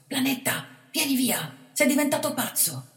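high, loud alarmed pleading; scared boy begging the bandit; frantic plea. A narrator's reading. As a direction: beg him to come away.

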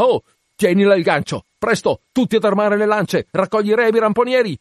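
urgent shouting, pitched right up; frantic orders. Acted. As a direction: drive the crew on without a break. Captain shouts orders to crew.

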